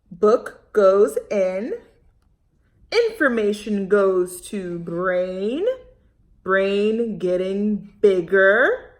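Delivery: walk through each step slowly; slow deliberate explaining. Acted. Teacher explaining diagram to children slowly.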